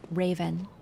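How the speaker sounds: quiet, low-key imitation of Air; lightly voiced calm radio operator; soft Air voice. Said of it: "Air going".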